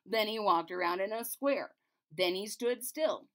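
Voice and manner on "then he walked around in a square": plain reading voice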